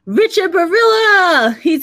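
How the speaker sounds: loud, high, drawn-out name; excited shout-out. Real, not acted. Host greets a viewer joining the live show, excited shout-out. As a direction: announce a friend's arrival like a big entrance.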